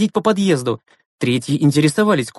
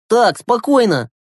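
higher, slow, emphatic command; raised-voice boy taking charge; sharp call to order; 7 semitones higher, slower than usual. Acted. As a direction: rein them in firmly, drawing the words out. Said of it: "прикрикнул Глеб".

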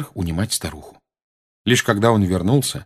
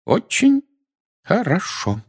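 breaking voice; 12 semitones higher, slower than usual. Acted. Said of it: "прерывающимся голосом".

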